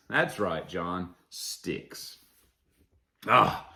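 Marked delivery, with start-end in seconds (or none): 1.3-2.2 very soft whispered name; hushed confirmation. Pretend. Confirming the band to John, hushed whisper.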